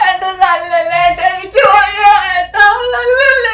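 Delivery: loud, high sobbing; crying plea. Acted. Wife begging husband not to shove her, sobbing. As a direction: beg him not to push her.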